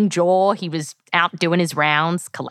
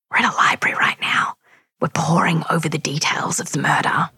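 library whisper; 2 semitones lower than usual. Real, not acted.

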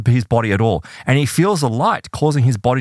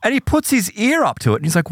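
ordinary delivery

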